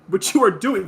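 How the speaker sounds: put-on a mother reasoning firmly with her child; logical mom. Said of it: "verbalizing her logic".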